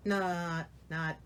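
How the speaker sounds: usual speaking voice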